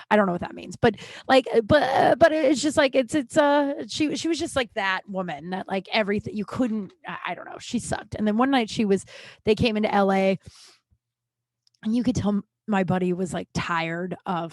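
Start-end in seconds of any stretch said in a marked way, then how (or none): none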